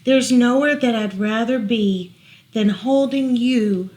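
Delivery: gentle storybook read-aloud; reading to children. Acted. Parent reading a bedtime book to child, gentle read-aloud.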